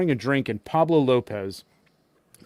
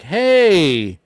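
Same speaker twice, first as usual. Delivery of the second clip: loud, high, drawn-out greeting; hailing someone in a louder, higher put-on voice; Iz Ramirez; 6 semitones higher, 8 dB louder than usual. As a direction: call out a big stretched hey in an impression. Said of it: "try and do my Iz Ramirez".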